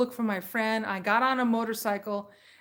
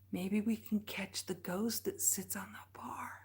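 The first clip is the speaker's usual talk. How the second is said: much softer, breathy ghost-story hush; spooky whisper; 3 semitones lower, 11 dB quieter than usual. Acted. Teasing viewers about the bar ghost, spooky whisper. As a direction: whisper it like a campfire tale.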